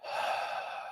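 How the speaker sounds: long, soft exhaled sigh; demonstrated sigh. Shown as a demonstration. Meditation teacher showing listeners how to exhale, demonstrated sigh. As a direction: sigh it out through the mouth, voiceless. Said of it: "You can sigh it out".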